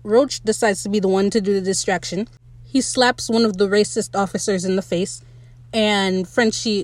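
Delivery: ordinary talk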